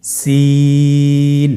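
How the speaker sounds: সিন with the vowel held three counts, thin and light; Arabic letter lengthened for learners; three alif stretch. Shown as a demonstration. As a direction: draw সিন out long. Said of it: "আমার সাথে বলুন".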